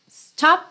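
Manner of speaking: "Stop" with a sharp, cut-off P; clipped P. Shown as a demonstration. A teacher showing the cut P ending "Stop". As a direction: cut the sound off hard on the P of "Stop". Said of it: "esse P do stop corta o som".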